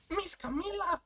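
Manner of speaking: very high, soft little voice; tiny put-on voice, high and small; squeaky game character. Small character calling Miss [name], soft very high voice.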